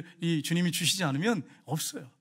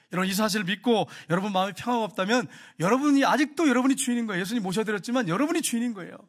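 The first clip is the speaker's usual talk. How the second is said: higher, louder preaching climax; fervent preaching; 4 semitones higher, 4 dB louder than usual. Acted. Pastor exhorting the congregation to trust Jesus, fervent and loud. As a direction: press the congregation with rising conviction.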